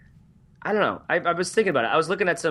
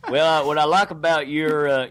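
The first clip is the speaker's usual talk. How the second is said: usual speaking voice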